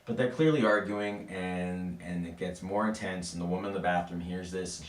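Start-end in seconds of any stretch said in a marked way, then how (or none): none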